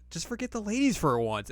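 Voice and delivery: lightly voiced bratty kid; Shippo. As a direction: snap at him like an exasperated kid.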